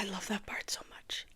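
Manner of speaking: barely voiced, very soft; whispered gushing. Real, not acted. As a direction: whisper it as a hushed confession. Gushes after singing the high note.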